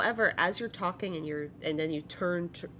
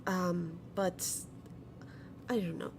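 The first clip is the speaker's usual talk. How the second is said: [name]; 5 semitones higher than usual. Acted. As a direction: answer gently in a lightly voiced sweet grandmother. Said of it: "[name] speaks up".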